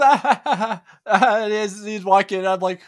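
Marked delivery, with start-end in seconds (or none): 0.0-1.4 high, loud mock laughing; imitated laugh. Greeter imitating the kid who laughs at the prank, loud mock laugh. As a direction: imitate a kid's big "ha, ha, ha". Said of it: "And he laughs".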